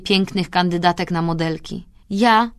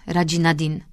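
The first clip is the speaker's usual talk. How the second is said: plain reading voice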